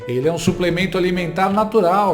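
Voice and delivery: upbeat commercial announcer voice; ad read. Acted. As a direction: sell it like a TV ad. Reads a product ad.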